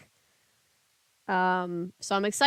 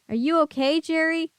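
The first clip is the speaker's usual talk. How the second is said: concerned; 7 semitones higher than usual. Acted. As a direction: call out with worry. Rescuer checks on boy.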